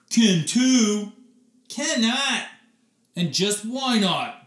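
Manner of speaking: put-on high, loud kid squabble; tit-for-tat retort; childish bickering. Oliver and Mary Margaret bicker in class, high and loud.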